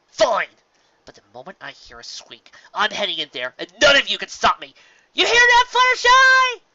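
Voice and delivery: loud, high yell toward the cave; a rising defiant cry, loudest at the end; defiant shout. Acted. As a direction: shout it out, daring anyone to stop her. Rainbow Dash shouts defiantly.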